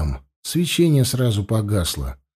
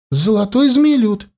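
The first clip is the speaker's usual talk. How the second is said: high excited blurt, sudden; 10 semitones higher than usual. A narrator's reading. Young Metta blurting at the glowing figure, excited. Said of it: "выпалил Метта".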